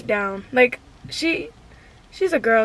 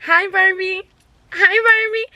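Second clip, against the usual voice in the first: high squealed hi Barbie; excited greeting; 7 semitones higher than usual. Retold or imitated. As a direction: greet Barbie like an excited fan, much higher. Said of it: "I said".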